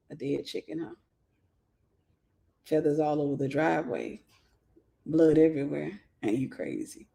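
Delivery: low, soft seductive murmur; turned-on perv. Retold or imitated. Perv murmuring to her about a dead chicken, low and soft. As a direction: purr it low like he's aroused.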